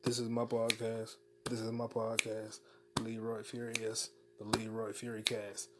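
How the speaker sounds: very soft, distant mumble; far off. Real, not acted. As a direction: drift away from the mic. Far off whisper at end.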